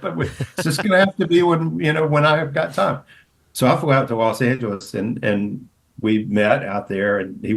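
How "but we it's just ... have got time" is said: higher put-on voice, easygoing; Denzel's offhand condition. Host imitating Denzel setting terms, in a raised put-on voice. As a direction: toss the condition off casually.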